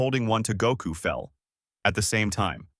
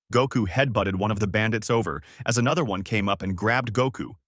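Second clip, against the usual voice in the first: usual speaking voice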